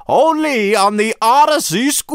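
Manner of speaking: big, slow, dramatic announcer voice; announcer tease. Acted. Host teasing next week's episode, booming announcer. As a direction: hype the cliffhanger like a trailer.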